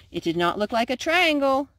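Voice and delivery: plain reading voice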